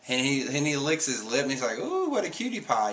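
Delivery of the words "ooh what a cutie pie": mock leering. Leering at Nami, licks his lip.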